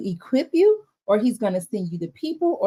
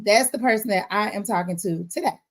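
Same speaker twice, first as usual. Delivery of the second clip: usual speaking voice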